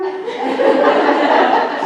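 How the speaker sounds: a high breathy laugh that rises; airy laugh. Real, not acted. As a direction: laugh at the joke on herself. Laughing after her reply.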